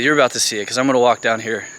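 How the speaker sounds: ordinary talk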